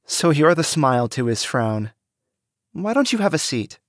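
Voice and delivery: teasing, inviting, a smile in it; amused young woman. A narrator's reading. Cassie amused.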